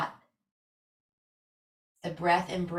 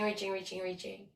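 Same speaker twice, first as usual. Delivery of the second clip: softer and softer with each reaching; fading repeated cue; 9 dB quieter, faster than usual. Shown as a demonstration. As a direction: let the word drift away to almost nothing. Yoga cue fading on stretch.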